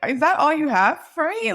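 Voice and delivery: high, loud disbelief; exasperated at the CPA's advice; incredulous. Retold or imitated. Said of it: "And I'm like".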